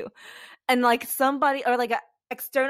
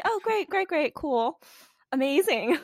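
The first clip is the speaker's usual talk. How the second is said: higher, mock cheerful; sarcastic; 7 semitones higher than usual. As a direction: lightly fake enthusiasm. Sarcastic reply to society.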